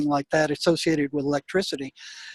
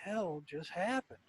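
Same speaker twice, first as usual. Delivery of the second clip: stunned murmur; 15 dB quieter, slower than usual. Retold or imitated. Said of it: "And I'm like".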